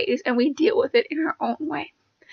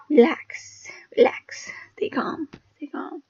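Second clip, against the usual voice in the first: hushed, breathy whisper fading softer; soothing self-talk; 3 semitones higher, 7 dB quieter, slower than usual. Acted. Telling viewers how medication helped her relax, in a soothing hush. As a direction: whisper it slowly, calming yourself. Acting out calming herself down.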